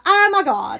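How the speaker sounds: loud, high gasp of wonder; awed delight. Acted. Imagining waking up in that room, awed delight. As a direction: exclaim it as if waking to magic. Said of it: "can you imagine the magic you would feel".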